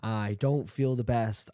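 normal talking voice

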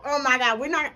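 normal talking voice

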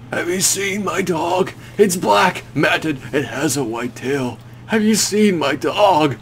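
high, slow, lost voice of a broken man; dazed grieving man. Acted. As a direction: ask as if the dog is gone.

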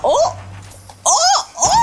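high, startled "oh" cries; startled yelps. Real, not acted. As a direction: yelp in alarm. Last attack in game.

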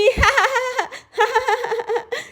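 mocking laugh. Guddu mocks sick girl.

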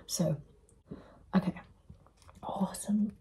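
soft whispered word; ASMR whisper. Acted. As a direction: whisper it close to the mic. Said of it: "Trying to do it like A S M R".

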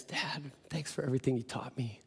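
soft breathy whisper of grief; grief-stricken whisper. Retold or imitated. Son whispering thanks to dead father, grieving. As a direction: hush it as if over a coffin.

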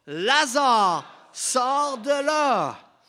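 huge, high, drawn-out cry; full-voiced shout. A narrator's reading. Preacher as Jesus summons Lazare from the tomb, shouting. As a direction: shout the command as loud as you can. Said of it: "Jésus cria d'une voix forte".

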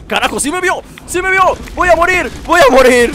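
high, loud yells of panic; panicked shouting. Real, not acted. Gamer spotted by an enemy, panicked shouting. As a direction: shout it high as doom closes in.

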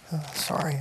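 fighting back tears while apologizing; choked-up apology; crying. Real, not acted. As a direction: voice breaking with tears. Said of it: "I hate crying in public".

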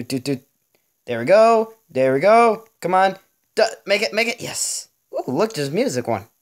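ordinary delivery

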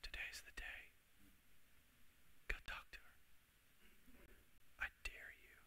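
hushed whisper of the Spirit urging. Acted. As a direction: breathe the dare like an inner nudge. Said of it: "you hear a whisper".

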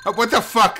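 sudden high startled outburst. Real, not acted. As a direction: jump at the shock and blurt it out. Sudden shock in horror game.

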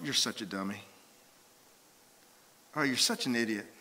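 low, muttered self-reproach; self-berating. Acted. Person berating himself, disgusted self-talk. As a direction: put yourself down under your breath. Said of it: "said, "Ah," to myself".

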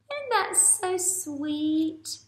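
very slow cooing question; gushing sweet. Real, not acted. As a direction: melt over the ending. Teacher gushing over ending.

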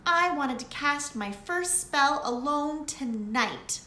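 little witch disappointed; a touch of whiny Lily. A narrator's reading. Lily complaining to Daisy, mildly upset.

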